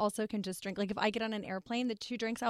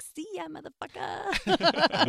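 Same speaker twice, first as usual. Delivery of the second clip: mock cheerful goodbye; 7 semitones higher, 4 dB louder, much slower than usual. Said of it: "So I was like".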